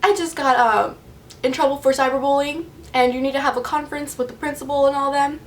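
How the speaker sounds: half-acted teary voice; imitated tearful. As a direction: retell it as if tearfully telling mom. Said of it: "I'm obviously crying".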